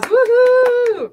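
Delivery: high, loud woohoo; cheering. Real, not acted. Guest cheering the host's big announcement. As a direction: cheer it out like a crowd.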